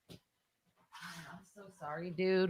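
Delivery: hushed, drawn-out sorry; soft apology. Real, not acted. Host apologizes to Meredith for the lost recording, softly.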